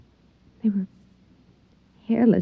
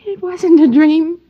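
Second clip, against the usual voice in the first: breathless thrill; 8 semitones higher, 4 dB louder than usual. Acted. Thrilled at visitor.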